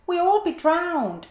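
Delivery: a panicked little mouse; squeaky mouse. A narrator's reading. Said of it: "squeaked the mouse".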